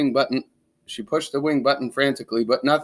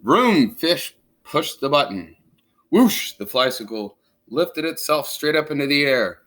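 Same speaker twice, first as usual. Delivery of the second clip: ordinary delivery, nothing marked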